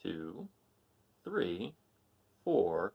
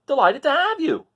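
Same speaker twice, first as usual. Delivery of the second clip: much higher, louder eager rooster; rooster's voice; 12 dB louder than usual. Acted. Rooster asking the cats to travel, high eager call. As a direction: chirp the invitation up high like a cocky rooster.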